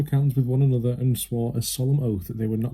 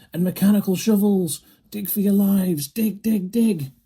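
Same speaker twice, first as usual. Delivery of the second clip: high urgent shouting; shouted; 9 semitones higher than usual. A narrator's reading. Mister Fox urging his family to dig, high urgent shout. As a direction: yell at them to dig for their lives. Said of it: "shouted Mister Fox".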